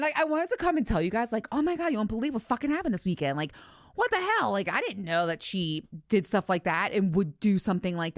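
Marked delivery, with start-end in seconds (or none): 0.0-3.4 excited. Real, not acted. Excited retelling to listeners. 3.9-4.5 a pitched-up exclaim of shock; disbelief. Real, not acted.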